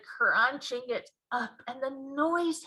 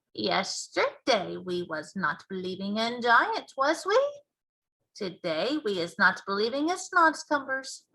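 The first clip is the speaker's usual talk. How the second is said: BFG giant voice; 3 semitones higher, 4 dB louder than usual. Acted. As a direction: boom out the giant's mangled grammar.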